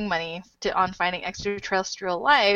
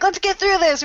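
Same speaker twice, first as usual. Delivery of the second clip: high, loud mimicry of a rushed host; teasing, high-pitched imitation; mock rushing; 10 semitones higher, 6 dB louder than usual. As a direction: playfully exaggerate someone hurrying everyone along. Mocking host's rushed opening.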